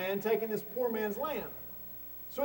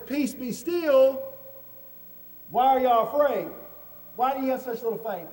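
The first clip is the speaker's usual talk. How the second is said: commanding rebuke; 3 semitones higher, 5 dB louder than usual. Retold or imitated. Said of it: "and Jesus says".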